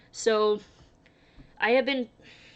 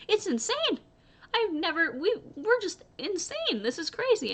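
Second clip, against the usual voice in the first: high exasperated voice, pitched way up and slowed; mock frazzled manager; 11 semitones higher, 3 dB louder than usual. Quoting the store manager.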